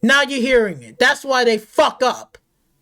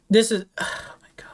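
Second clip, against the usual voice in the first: sigh, then breathe the words without voice, softer; whispered disbelief, almost to himself; exasperated whisper; 3 semitones lower, 5 dB quieter than usual. Real, not acted. Gamer ranting at Sony, drops to a sighing whisper.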